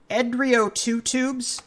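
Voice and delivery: Edrio Two Tubes read slowly, word by word, unsure; careful name reading. Real, not acted. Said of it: "if I mispronounce, I apologize".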